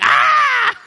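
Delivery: high, loud mock shriek of fright; big comic scream of surprise; startled scream. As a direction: shriek like the disciples seeing a ghost. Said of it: "And everyone's like".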